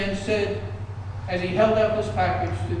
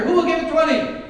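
auctioneer call; 10 semitones higher, 7 dB louder than usual. Acted. Auctioneer calls for higher bid.

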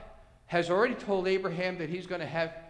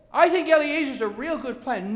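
arguing Abraham; 6 semitones higher, 5 dB louder than usual. Acted. Abraham argues for his own plan.

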